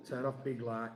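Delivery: a light northern English accent on big light. Shown as a demonstration. Telling his co-host to switch the light, in a light northern accent. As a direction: drop into a hint of northern English for the catchphrase. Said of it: "I'm supposed to say it in that accent".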